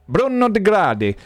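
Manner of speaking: high, hammed-up Italian accent; fake Italian accent. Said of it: "fake Italian accent".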